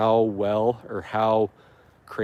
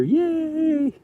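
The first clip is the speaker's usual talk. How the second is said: a very high, squeaky playful "yay"; mock cheer. Cheering the organic matter a second time, squeaky high yay.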